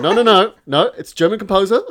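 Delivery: high, loud, brushing-off imitation of dad; mock insistent dad. Dad dismisses the bad news, high and loud. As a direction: wave away the emergency, fixated on music. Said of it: "He'd be like".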